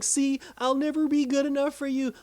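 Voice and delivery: mock-upset high-pitched whine; upset son. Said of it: "he gets upset".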